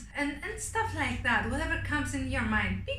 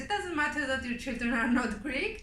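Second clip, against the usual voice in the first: usual speaking voice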